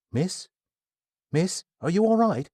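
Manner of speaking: put-on flustered young man. Kai apologizing to Athena after nearly hitting her, flustered. Narrator voices worried Kai.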